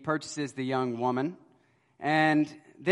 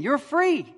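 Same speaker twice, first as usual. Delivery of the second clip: "You're free" pitched high and a bit louder; insistent repeat; 11 semitones higher, 3 dB louder than usual. Retold or imitated. Rich man repeating her freedom to the girl, high insistent. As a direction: say it once more, lifting high. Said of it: "And he says it again".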